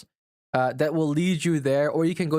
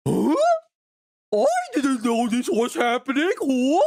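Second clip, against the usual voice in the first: dumb chatter mockery; 9 semitones higher, 4 dB louder than usual. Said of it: "and be like".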